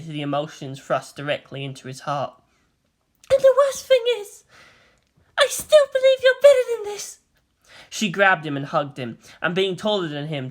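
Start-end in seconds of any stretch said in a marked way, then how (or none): 3.3-7.2 put-on high, louder, crying young woman; tearful Maya. Maya crying to Shinji that she still believes. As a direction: break down on the words.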